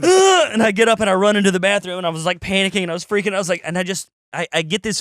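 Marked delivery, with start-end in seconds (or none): 0.0-0.5 loud, high strangled groan; panicked groan. Retold or imitated. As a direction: let out a frantic high groan. Panicking in the brewery.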